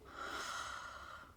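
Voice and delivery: long, soft weary sigh; heavy sigh, all air; exasperated sigh. Real, not acted. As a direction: let out a quiet, frustrated breath. Frustrated retelling of strangers judging.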